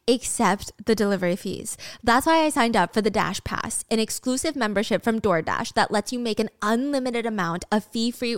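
ad read. Acted. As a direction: read the sponsor spot smooth and friendly. Sponsor ad read.

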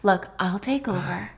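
ordinary delivery

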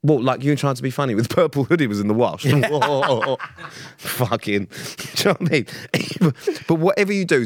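laughing through the words; laughing about his own caption. Real, not acted. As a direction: crack up while talking.

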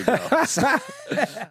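slow, high, laughing drawl. Real, not acted. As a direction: stretch it out through a laugh. Laughing joke about silent auctions.

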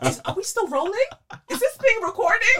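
high, loud fake alarm; mock panic. Host pretends to fret the recording caught her joke, mock panic. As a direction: pretend to check the mic in a fluster.

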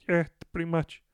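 high, strained little guy voice; squeaky sheepish lizard-man confessing; [name]'s caller voice. Acted.

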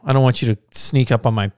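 normal talking voice

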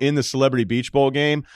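ordinary talk

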